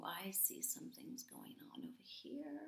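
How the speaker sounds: soft secretive whisper. Acted. Secret aside to child listener.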